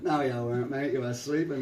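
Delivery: loud London lad's put-down; London accent. Retold or imitated. Young London soldier contradicting the monk, London accent. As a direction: call it out in cheeky London accent. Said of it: "is a young guy from London".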